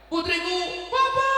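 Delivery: very high, loud, dramatic calling; a melodramatic movie-style cry of the daughter's name. Shown as a demonstration. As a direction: ham it up like a film reunion. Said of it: "Ayo, kayak film-film gitu".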